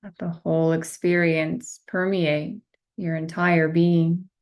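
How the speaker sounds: slow, measured meditation guide voice with long pauses; guided meditation. Acted. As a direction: guide them gently into stillness, one phrase at a time.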